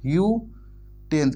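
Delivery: यूटेंस- stretched very slowly; drawn-out sound. Shown as a demonstration. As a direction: draw out यूटेंस- to show the first sound. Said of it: "first letter देखना".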